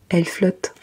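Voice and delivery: usual speaking voice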